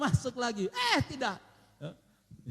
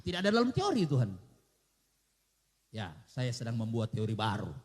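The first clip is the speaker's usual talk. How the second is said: normal talking voice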